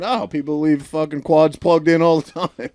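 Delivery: lightly voiced exasperated dad; mock dad's complaint. Imagined dad grumbling about the fire.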